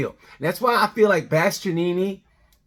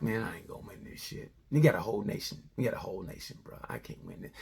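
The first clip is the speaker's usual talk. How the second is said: defeated mutter; 8 semitones lower, 13 dB quieter than usual. Retold or imitated. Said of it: "Foreman was like".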